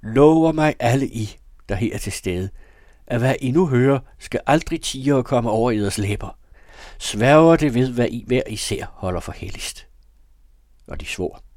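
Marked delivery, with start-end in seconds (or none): 0.0-9.9 loud, high-pitched solemn demand; commanding cry. A narrator's reading. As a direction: call out as if swearing in a whole room. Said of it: "Der råbte min mor".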